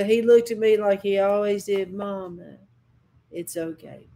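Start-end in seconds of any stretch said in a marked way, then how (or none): 1.9-4.1 hushed, giving in gently to Mama; quiet reassurance. Retold or imitated. Hurt son tells his mother not to worry, quiet reassurance.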